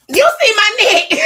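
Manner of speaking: high and loud, laughing through the question; loud excited squeal. Real, not acted. Asking viewers about her neck on camera, loud excited squeal.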